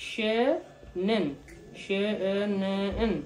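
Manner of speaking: শেনেন drawn out very slowly, each syllable clear; slow pronunciation. Shown as a demonstration. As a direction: stretch শেনেন like a teacher, she-nen. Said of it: "তোমারটা হবে হচ্ছে শেনেন". (ধীরে ধীরে টেনে শেনেন)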